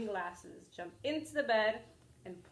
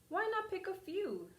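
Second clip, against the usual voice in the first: coaxing wolf; 10 semitones higher than usual. Acted. Wolf suggests flowers.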